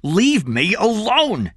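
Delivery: angry, drawn-out high shout. A narrator's reading. Eddie fends off Kathy's questions, shouting. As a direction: yell it slowly and loudly. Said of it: "he shouted".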